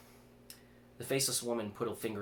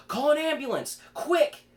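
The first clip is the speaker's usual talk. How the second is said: much higher and louder frantic yell; 12 dB louder than usual. A narrator's reading. Vic seeing Chris bleeding, yelling frantically. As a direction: scream for help across the lobby. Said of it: "Vic yelled".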